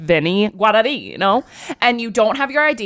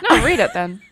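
normal talking voice

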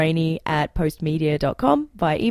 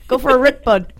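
mock Canadian slang; 4 semitones higher, 4 dB louder than usual. Shown as a demonstration. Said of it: "teach me how to talk proper Canadian".

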